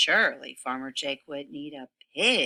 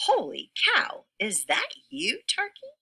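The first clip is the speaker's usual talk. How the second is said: very high squealing surprise; surprised pig; 11 semitones higher than usual. Acted. Pig spotting Turkey in a pig costume, high squeal of surprise.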